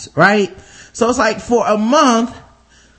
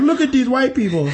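bad Nigerian accent; mock Nigerian accent, lightly done; 4 semitones higher than usual. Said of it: "that's a terrible Nigerian accent".

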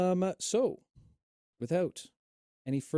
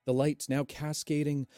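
game master narration. Acted. Game master's scene setting.

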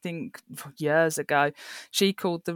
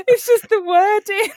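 laughing hard; 6 dB louder than usual. Real, not acted.

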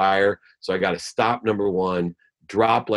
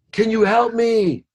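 high, loud drawn-out cry for help; screamed plea; 5 dB louder, slower than usual. Acted. Man hanging from a branch begs God for help, screamed plea. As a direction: holler up the cliff, pleading. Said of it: "he looks up and he screams".

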